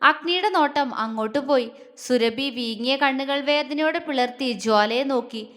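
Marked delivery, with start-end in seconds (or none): none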